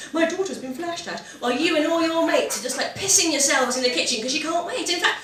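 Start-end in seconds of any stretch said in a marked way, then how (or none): 0.1-1.2 mum's prim phone manner, a touch higher and softer; posh phone voice. Retold or imitated. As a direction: report it to the police in your best posh voice. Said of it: "it's just posh".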